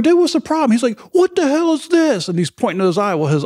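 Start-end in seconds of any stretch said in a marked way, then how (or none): 0.0-0.7 mock high casual question; retold self, high voice. Retelling asking Todd what's wrong, in high playful voice. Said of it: "I'm like". 1.1-2.3 high, slow mock panic; imitating Todd panicking. Mimicking Todd panicking over his mask eye.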